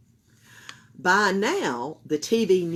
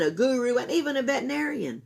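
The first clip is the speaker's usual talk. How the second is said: plain reading voice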